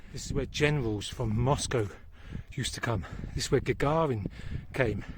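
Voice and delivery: ordinary talk, nothing marked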